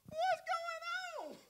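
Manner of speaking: very high, soft and slow, playing innocent. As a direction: squeak it out like a harmless little man. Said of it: "that's exactly how it was".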